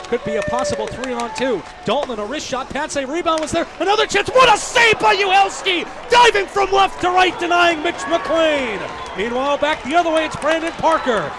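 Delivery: building from brisk to shouting at the big save; hockey call rising to a roar; play-by-play climbing into a full shout; excited play-by-play. Real, not acted.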